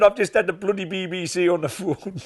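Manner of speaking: high, gleeful, breathless news; excited manager. Retold or imitated. Manager announcing the BBC call, high and excited. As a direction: spill it out high and thrilled. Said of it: "he said".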